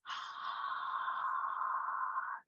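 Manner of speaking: long breathy whoosh through the mouth; audible exhale. Shown as a demonstration. Yoga teacher to class, demoing the exhale. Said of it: "Exhale through the mouth with the noise".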